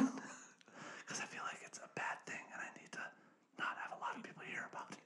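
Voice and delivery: secretive whisper with a laugh. Real, not acted. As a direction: whisper like hiding a guilty pleasure. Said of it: "this voice is a turn you on".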